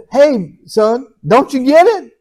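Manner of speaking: God nudging his son to finally get it; a hint of a chiding dad.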